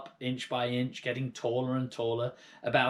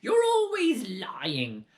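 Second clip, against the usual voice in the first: slow, high, loud hag accusation; sneering crone yell; 10 semitones higher, 6 dB louder, much slower than usual. Acted. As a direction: drag out the accusation. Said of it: "Grandma yelled".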